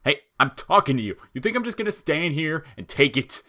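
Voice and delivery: loud, high and furious; angry shout. A narrator's reading. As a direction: yell at him for walking away. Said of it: "He summoned up all his remaining power and attacked".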